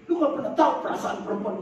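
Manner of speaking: high, rapid complaint; mock upset woman. Woman answers boyfriend in quarrel, mock-upset and fast.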